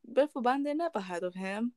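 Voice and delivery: ordinary delivery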